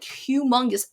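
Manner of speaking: usual speaking voice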